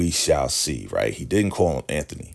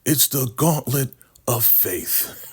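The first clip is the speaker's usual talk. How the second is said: slow, higher, hollow proclamation; solemn cult voice; 7 semitones higher, slower than usual. Retold or imitated. River solemnly naming the punishment, mock grave. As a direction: announce it like a cult elder.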